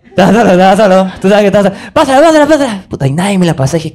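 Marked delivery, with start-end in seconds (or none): 1.9-2.8 higher, louder pleading shout; calling for the pass. Retold or imitated. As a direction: demand the ball from teammates.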